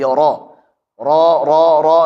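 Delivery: "ra" heavy and rounded, close to "ro"; thick ra. Shown as a demonstration. Teacher models tafkhim, heavy "ra". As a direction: thicken "ra" with a backed vowel. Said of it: "ra tebal".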